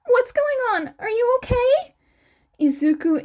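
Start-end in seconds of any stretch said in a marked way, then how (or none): 0.1-1.9 higher voice for Shinso, asking what's wrong; voiced friend on the phone, concerned; worried friend. A narrator's reading. Shinso picks up the call, worried and higher.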